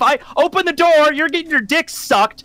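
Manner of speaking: a fed-up courier scolding the boss; exasperated rant. Acted. Player's courier rants at boss.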